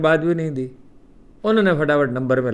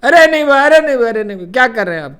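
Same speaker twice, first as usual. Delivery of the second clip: calling out loud and high from behind to stop him; loud call from behind, much higher; 7 semitones higher, 9 dB louder, much faster than usual. Retold or imitated. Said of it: "پیچھے سے میں نے آواز دی".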